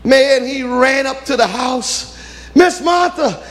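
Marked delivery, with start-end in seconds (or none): 2.5-3.4 high breathless call; out of breath. Acted. Messenger arriving breathless, calling for Martha. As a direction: gasp her name after running. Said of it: "he's all out of breath".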